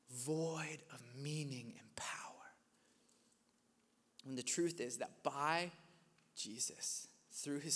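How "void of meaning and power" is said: hushed, breathy drop; whisper. Acted. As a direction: let it fall to a whisper. Sermon point dropped to whisper.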